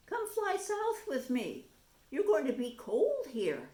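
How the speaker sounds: eager little bird; bright tanager. Acted. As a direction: coax a friend to fly south. Tanager invites mouse south.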